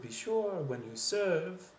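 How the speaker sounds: French-accented, high swoops on "sure" and "serve"; French rising inflection. Shown as a demonstration. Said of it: "So instead of".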